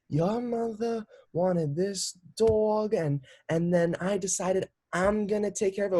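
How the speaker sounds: high, very slow, put-on posh voice; grandma's interview voice. Granddaughter mimics grandma's fake on-camera voice, high and drawn out. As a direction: play a grandma acting like she's on Barbara Walters, stretching every word. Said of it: "She put on this voice".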